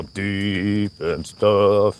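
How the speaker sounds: slow, drawn-out show of a deep voice. Shown as a demonstration. Vlogger showing viewers his voice, playfully deep and slow. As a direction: stretch the words to show off a deep voice. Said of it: "I know my voice sounds".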